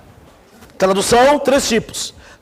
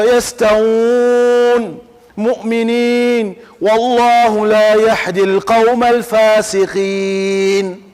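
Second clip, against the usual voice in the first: slow Quranic chant, long held vowels; sustained melodic recitation; mosque recitation; much slower than usual. Shown as a demonstration. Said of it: "às vezes vai na mesquita".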